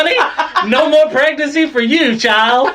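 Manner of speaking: high, sassy, laughing auntie voice. Acted. Mock sassy lady scolding about pregnancy, high and laughing.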